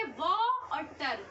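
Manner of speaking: व और टर drawn out very slowly, each syllable apart; syllables spelled out. Shown as a demonstration. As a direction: stretch व and टर into separate beats. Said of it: "कैसे?". (बहुत धीरे, शब्दांश अलग अलग)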